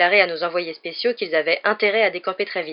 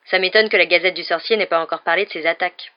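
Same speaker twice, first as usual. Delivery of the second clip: plain reading voice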